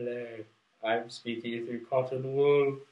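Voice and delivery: slow words muffled by cotton wool; through cotton wool. Shown as a demonstration. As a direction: talk slowly into a wad of cotton wool. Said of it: "now I'm gonna do".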